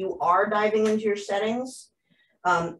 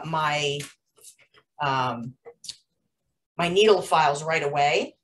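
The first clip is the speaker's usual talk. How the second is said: normal talking voice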